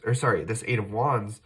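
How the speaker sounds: ordinary talk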